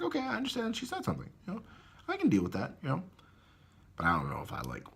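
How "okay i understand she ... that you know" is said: much higher, fast, sing-song fake-nice voice; mock sweet reply. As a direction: play it falsely understanding, fast and high. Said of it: "So I'm like".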